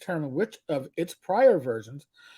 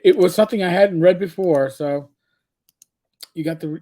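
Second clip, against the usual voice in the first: normal talking voice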